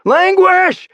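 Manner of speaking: very high, loud call; shouted across the gap. Acted. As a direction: call him from far off. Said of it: "I shouted across the gap".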